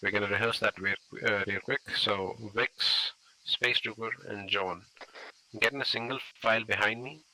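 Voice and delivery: loud voice carried across a road; projecting voice. Real, not acted. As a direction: project to the team on the far side.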